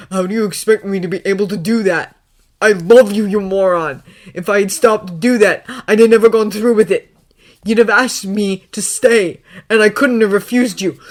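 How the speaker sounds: loud, impassioned pleading; desperate shouting. A narrator's reading. Harry tearfully justifying himself to Draco, desperate shouting. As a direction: plead at full volume. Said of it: "Harry shouted".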